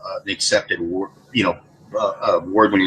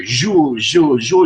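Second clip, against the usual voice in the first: mock Portuguese mush, a touch higher and louder; imitating mushy Portuguese; mushy Portuguese sounds; 5 semitones higher, 5 dB louder, much slower than usual. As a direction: mimic slurry Portuguese syllables. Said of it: "It's a mushy language".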